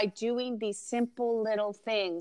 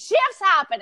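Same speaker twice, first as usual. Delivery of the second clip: mock exclaim; 9 semitones higher, 6 dB louder, slower than usual. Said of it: "He's like".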